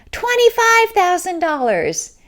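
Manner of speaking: high, loud, triumphant outbid; imitating a bold bid. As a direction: stand up and top her bid. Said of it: "a man stands up and says".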